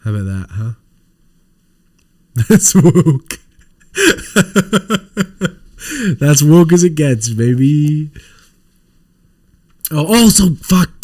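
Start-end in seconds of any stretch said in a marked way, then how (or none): 2.3-6.2 chuckling out the punchline; laughing. Real, not acted. Streamer joking to chat, laughing. 6.2-8.1 louder, still giggling; amused boast over a laugh. Real, not acted. Streamer boasting to chat, still laughing.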